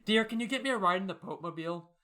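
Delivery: ordinary talk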